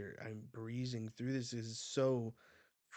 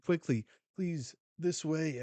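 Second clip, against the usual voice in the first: higher, louder hurrying call; acted urgent call; 6 semitones higher, 7 dB louder than usual. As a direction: usher a crowd along, play-acting. Marines urging people to evacuate.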